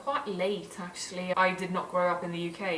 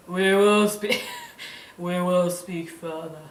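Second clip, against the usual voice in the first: slower, laughing retake; slow, stumbling retake of the line; Lady Macbeth line retaken; 6 dB louder, slower than usual. Acted. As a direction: try the line again.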